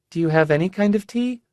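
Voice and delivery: lightly voiced sick young woman, high; airy, distracted girlish voice; high fragile young woman. Rebus voiced by narrator.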